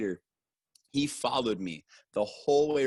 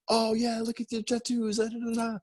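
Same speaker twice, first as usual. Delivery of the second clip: much higher, squeaky mock vendor voice; imitating eager vendors; 13 semitones higher than usual. Storyteller mimics vendors crowding him, high mock voice. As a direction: pitch way up like a pushy stranger.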